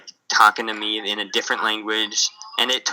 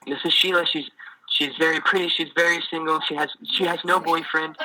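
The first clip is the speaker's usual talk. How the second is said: higher, selling his daughter like a product; salesman dad; 6 semitones higher than usual. Retold or imitated. Missionary voicing the dad selling his single daughter, higher. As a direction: praise her like a proud, pushy salesman.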